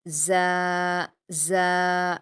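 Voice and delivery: زا said firmly but thin with spread lips. Shown as a demonstration. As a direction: hold زا long and light. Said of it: "زا کو آپ سختی سے پڑھیں گے لیکن باریک پڑھیں گے". (زا سختی سے مگر باریک)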